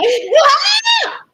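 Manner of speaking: a loud, high laughing shriek; real laughing shriek. Real, not acted. Paint on washed hands.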